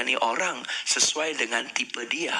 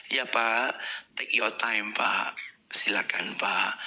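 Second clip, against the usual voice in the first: slow, patient service; 4 semitones lower, much slower than usual. Shown as a demonstration. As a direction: give him all the time in the world. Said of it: "ritmenya rubah".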